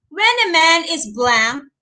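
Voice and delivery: normal talking voice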